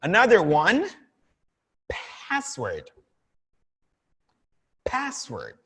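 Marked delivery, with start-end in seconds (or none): 1.9-5.5 "password" with a strong aspirated P. Shown as a demonstration. Teacher showing students the strong English P. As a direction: puff air hard on the P of "password". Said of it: "That very powerful P".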